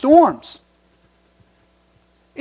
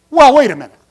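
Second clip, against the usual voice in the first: usual speaking voice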